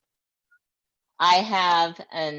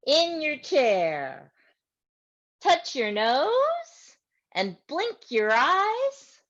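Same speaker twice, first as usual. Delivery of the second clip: put-on reading to children; 6 semitones higher, 5 dB louder, slower than usual. Reads action poem to children.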